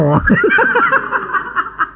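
bright high laugh. Real, not acted. Preacher laughing at his own story, a high laugh.

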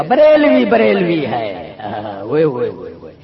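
slow, put-on voice mimicking opponents, then a low jeering "وے وے"; mocking mimicry. Preacher mocks rival sect in a slow put-on sneer. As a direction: ham up the rivals' chant in a sneering voice.

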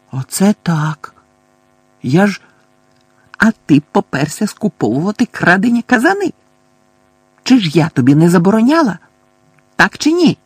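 put-on old woman scolding the absent guard; angry scolding. A narrator's reading. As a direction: tear into him for leaving. Mrs Figg scolding Mundungus.

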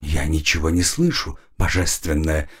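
low, meek servant voice; humble servant. Acted. Kneeling slave answers goddess.

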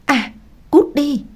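high, drawn-out cry of alarm; startled scream. A narrator's reading. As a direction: cry out on reflex, pitched well up. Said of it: "vô thức hét lên".

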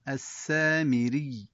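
السامري stopped, doubled ي held clearly, falling; stop with sukun. Shown as a demonstration. Teacher shows the stop with shadda kept. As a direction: close السامري on a stressed doubled ي. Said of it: "الوقف بالسكون مع إظهار التشديد".

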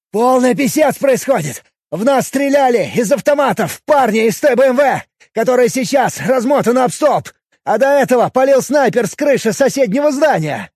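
loud, high agitated report. Acted. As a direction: rattle it off loud and alarmed. Panicked officer reporting shooting.

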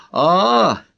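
long, high "ah" of understanding; high, stretched-out oh-I-see; drawn-out realisation. A narrator's reading. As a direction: draw it out high as if it finally dawns. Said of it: "протянула она".

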